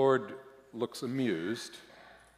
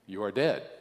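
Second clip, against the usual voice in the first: plain reading voice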